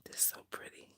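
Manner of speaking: breathy whisper to herself; whispered admiration. Real, not acted. Whispering to herself over her pretty craft. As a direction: hush it, no voice at all.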